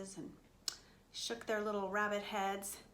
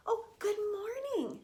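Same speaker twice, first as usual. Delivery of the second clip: high, lifted mouse voice; friendly mouse greeting; 9 semitones higher, faster than usual. Acted. Mouse says good morning to lambs, high greeting. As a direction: greet them in a high little voice.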